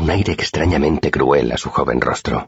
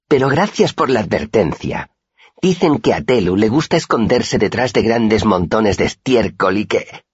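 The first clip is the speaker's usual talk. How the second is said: mild mocking sneer; 8 semitones higher than usual. A narrator's reading. Boy mocking the pious one.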